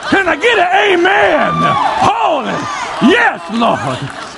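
a parody of a fired-up churchgoer; hammed-up worshipper. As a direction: play an eager congregant crying out praise. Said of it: "You go".

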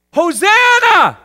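long, high shout of Hosanna; crying out. Acted. Preacher acts the crowd greeting Jesus, crying out. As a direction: cry it out loud and drawn out. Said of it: "people are all crying out".

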